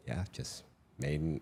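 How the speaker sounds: usual speaking voice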